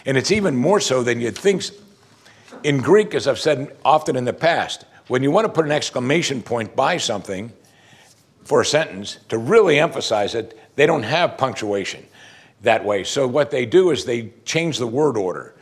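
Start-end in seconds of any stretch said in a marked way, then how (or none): none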